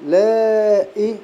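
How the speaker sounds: آلاء drawn out very slowly on the long a; آلاء chanted with a long held madd; stretched madd. Shown as a demonstration. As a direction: hold the long vowel of آلاء. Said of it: "اجتمع".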